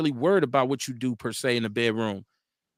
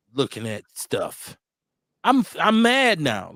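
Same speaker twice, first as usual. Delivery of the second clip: normal talking voice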